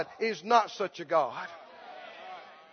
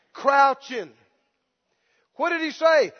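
normal talking voice

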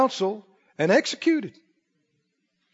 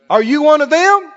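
interrogating; 6 semitones higher, 6 dB louder than usual. Acted. Preacher acts the interrogator.

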